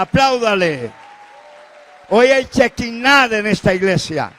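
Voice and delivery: loud, ringing pulpit exhortation; fiery preacher calling out to the congregation; full-force preaching. Acted. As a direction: preach it out to the whole church.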